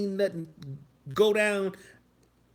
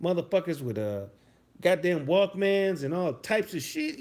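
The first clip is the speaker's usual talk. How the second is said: usual speaking voice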